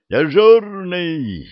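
high, loud, slow yell of the name; shouting. Acted. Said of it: "орёт в микрофон".

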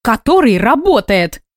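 sharp indignant outburst; flaring up. Acted. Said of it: "взвилась Инга Максимовна".

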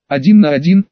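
ordinary delivery, nothing marked

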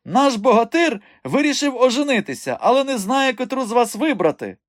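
high-pitched teasing announcement; calling out. A narrator's reading. Man teasing his friend before the girls, calling out. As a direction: proclaim it across the yard at a high pitch. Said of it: "загукав він до Ренати з Мілкою".

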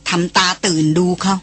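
ordinary delivery

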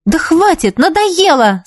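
high-pitched exasperated protest; fed up. A narrator's reading. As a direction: cut off the squabble, exasperated. Fed up with the quarrel. (раздражённо, с досадой)

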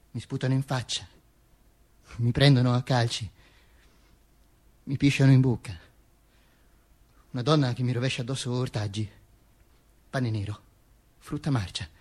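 low, hushed, halting litany; subdued victim. Acted. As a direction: list each blow softly, with long pauses. Victim recounts being abused. (voce sommessa e abbattuta)

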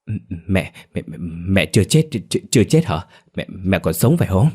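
stunned stammer, tripping over each word. A narrator's reading. Son stammering at his mother come back alive, stammering. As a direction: stammer in shocked disbelief. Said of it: "chừng mới lắp bắp".

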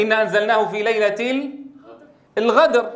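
الغدر with the qaf turned into a throaty ghain; Sudanese qaf as ghain. Retold or imitated. Teacher imitates how Sudanese people say القدر, qaf as ghain. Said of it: "هكذا يلفظ القاف غينا".

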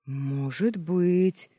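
very slow, stretched-out musing; thoughtful drawl. A narrator's reading. Mr Ичин ponders a possible family link, thoughtful drawl. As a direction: draw the words out while pondering. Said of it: "задумчиво протянул мистер Ичин".

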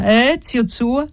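calling out. A narrator's reading. Gantine leaning over the old man's bed, calling out. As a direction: call questioningly to a sick man. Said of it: "gridò poi piegandosi sul letto".

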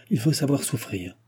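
plain reading voice

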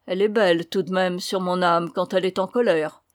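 plain reading voice

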